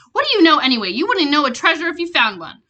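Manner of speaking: loud, indignant mother yelling; angry yell. A narrator's reading. Mother yelling at the big man. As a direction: yell back defending her son. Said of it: "His mother yelled".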